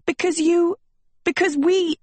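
high, urgent false starts; acted frustrated outburst; exasperated. A narrator's reading. Agatha arguing, correcting herself mid-sentence.

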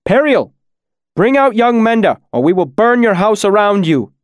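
high, loud, threatening shout; crying out. A narrator's reading. The smith threatening the woman inside her house, crying out. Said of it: "the smith cried out again".

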